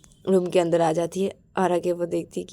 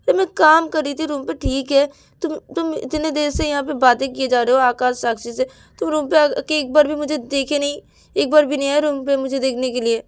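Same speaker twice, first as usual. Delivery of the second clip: higher, louder accusing tone; hurt anger; 7 semitones higher, 4 dB louder than usual. Acted. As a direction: scold her for ignoring you. Ananya confronts Payal angrily.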